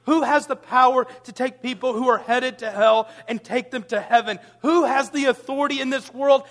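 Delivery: rising rhetorical fire; building preacher's cry. Real, not acted. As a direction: preach it up to a ringing climax. Preaching climax, rising volume.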